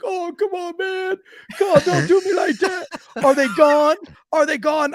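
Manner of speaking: high, whining man in pain; whiny injured man. Retold or imitated. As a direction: whine like a hurt man begging.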